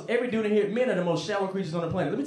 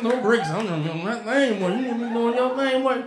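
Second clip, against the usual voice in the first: put-on mumbling old uncle; 5 semitones higher than usual. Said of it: "he start mumbling".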